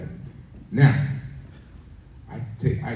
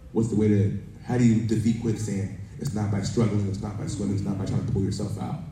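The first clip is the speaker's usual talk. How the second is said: ordinary talk, nothing marked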